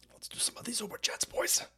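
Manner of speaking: whisper. Real, not acted. Host to chat, whispering before reading messages. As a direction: lean in and whisper it like a secret.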